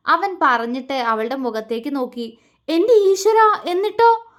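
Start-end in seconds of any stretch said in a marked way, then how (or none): 2.7-4.3 much higher and louder alarm; anxious. A narrator's reading. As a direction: gasp at what happened next. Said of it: "ആശങ്കയോടെ ചോദിച്ചു".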